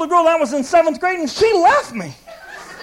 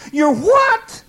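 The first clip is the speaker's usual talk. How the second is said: louder, higher shout of disbelief; incredulous shout; 6 semitones higher, 4 dB louder than usual. Pretend. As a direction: blurt it out in disbelief. Incredulous reply to jealous God.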